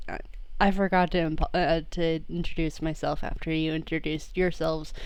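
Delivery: a hint of a low bashful detective; Sammy character voice. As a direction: introduce yourself with a shy shrug.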